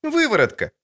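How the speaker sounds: put-on a sharp, high girlish retort; girl's voice. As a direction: contradict him like a cheeky girl. Ciri voice.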